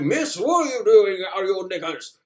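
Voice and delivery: high, posh-ish British scolding; mock British guard chasing her. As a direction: play the fussy English guard. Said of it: "He's like".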